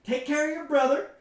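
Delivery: high, loud flustered mother; harried mom voice. Acted. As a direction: rush them like a busy mom.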